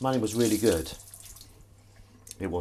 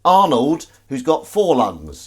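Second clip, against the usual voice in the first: raised, loud voice of a pretend boss; boss's retort; 7 semitones higher, 8 dB louder, slower than usual. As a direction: play the boss answering back loudly. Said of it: "And he said".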